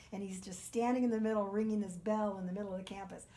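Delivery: ordinary delivery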